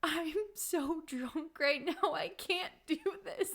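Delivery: put-on high, slow drunken whimper; retold drunk whine to her sister; whiny drunk. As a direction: plead like you're too drunk to cope. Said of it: "I'm like".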